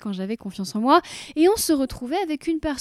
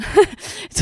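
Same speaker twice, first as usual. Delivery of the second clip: breathy laugh; 7 semitones higher, 6 dB louder than usual. Real, not acted. Laugh after self-mocking remark.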